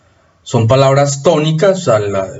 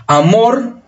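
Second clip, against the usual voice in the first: "amor" drawn out, rising on the last syllable; stress on second syllable; 9 semitones higher than usual. Shown as a demonstration. Teacher showing students where the accent falls in "amor", stressed last syllable. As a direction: lean on the "mor" of "amor", pitch lifting. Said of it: "tiene acento en esta sílaba".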